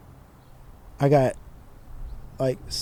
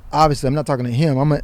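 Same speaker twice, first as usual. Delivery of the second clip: ordinary talk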